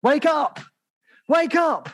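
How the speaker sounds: high ringing wake-up cry; sharp, high, wake up cry; urgent call. Pretend. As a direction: call out like an alarm going off. Mimicking an alarm call.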